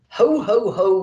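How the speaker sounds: jolly booming ho ho ho; Santa's ho ho ho. A narrator's reading. Santa laughing as the sleigh takes off, hearty. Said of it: "laughed Santa".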